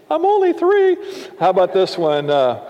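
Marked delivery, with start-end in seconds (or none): 0.1-1.0 put-on childish whine. Child answering dad, squeaky voice.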